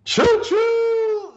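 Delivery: high shouted choo choo; train whistle call. Acted. Bomps Express train sound.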